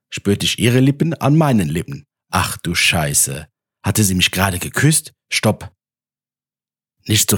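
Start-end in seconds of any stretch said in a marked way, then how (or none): none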